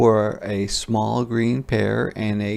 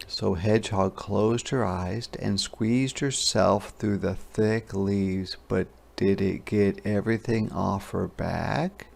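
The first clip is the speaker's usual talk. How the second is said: plain reading voice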